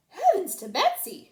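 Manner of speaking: high, loud astonished cry; exclaiming. A narrator's reading. The waitress exclaims on seeing Cat, amazed. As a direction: gasp it out in amazement. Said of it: "she exclaimed".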